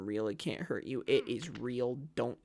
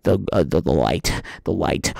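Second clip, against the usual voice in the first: loud, much lower booming near-death voice; dramatic deep voice; 14 semitones lower, 10 dB louder than usual. Acted. Gamer after a fall, booming dramatic narration. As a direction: boom it out like a movie trailer.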